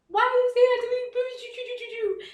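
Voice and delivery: high, slow, hurt pleading; a hint of a hurt girl pleading; plaintive young self. Retelling how she begged her daughter's father, high and slow. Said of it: "And I would say".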